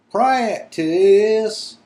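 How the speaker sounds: high furious squeal. Acted. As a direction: squeal it high and loud in outrage. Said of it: "squealed Winky furiously".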